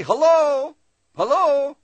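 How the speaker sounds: high, loud hello into an empty shop; calling out. Retold or imitated. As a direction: call toward the back room.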